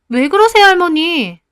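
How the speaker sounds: high, loud startled cry to grandma; startled protest. Acted. Granddaughter cries out after grandma slaps her hand. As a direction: yelp it out in shock.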